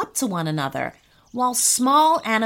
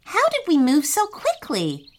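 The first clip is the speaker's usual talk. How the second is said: higher-pitched puzzled question; confused; 6 semitones higher than usual. A narrator's reading. Boy asks brother how they escaped, confused. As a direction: ask it in mild bafflement.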